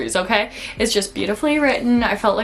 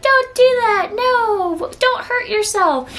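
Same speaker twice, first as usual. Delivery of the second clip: mocking husband; 13 semitones higher, slower than usual. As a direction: ham up the worried husband, pitched way up. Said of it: "her husband's like".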